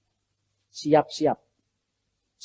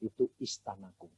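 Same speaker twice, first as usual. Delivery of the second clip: voice of God; 9 semitones lower, 17 dB quieter than usual. Retold or imitated. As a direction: speak down gently as the Lord. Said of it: "itu gedungnya siapa Tuhan".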